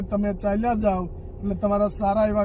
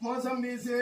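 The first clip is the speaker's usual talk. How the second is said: gentle consoling; 2 semitones higher, 7 dB quieter, faster than usual. Real, not acted. Visitor comforts a crying elderly woman, softly coaxing. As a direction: soothe her like calming a grandmother. Offering food to the old woman.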